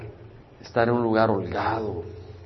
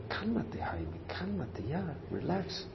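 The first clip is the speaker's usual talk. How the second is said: soft, slow and higher self-calming; soothing himself; 6 semitones higher, 9 dB quieter, much slower than usual. Retold or imitated. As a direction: talk yourself down gently. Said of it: "y le dice". (calmándose a sí mismo, suave y lento)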